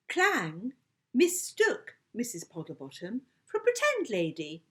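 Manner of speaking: ordinary delivery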